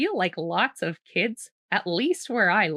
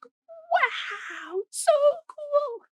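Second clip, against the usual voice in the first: put-on much higher, amazed child's wow; awed little girl; much slower than usual. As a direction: gasp in wonder at the sky. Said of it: "the girl's like".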